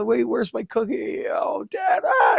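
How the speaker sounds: high, climbing mock wail; imitated kid crying. Kid protesting to dad over a missing cookie, mock crying. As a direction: whine up into a hammed-up childish shriek. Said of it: "they're, like, crying".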